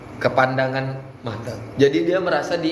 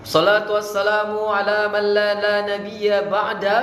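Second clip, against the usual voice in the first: raised, sustained chanting tone; measured Arabic recitation; 4 semitones higher than usual. Shown as a demonstration. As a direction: hold the vowels as in a formal opening. Coach demonstrates opening of speech.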